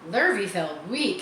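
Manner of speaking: ordinary delivery, nothing marked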